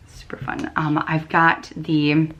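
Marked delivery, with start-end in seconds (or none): 0.1-0.7 whispered aside. Real, not acted. Knitter whispering "Super fun." to herself over her yarn.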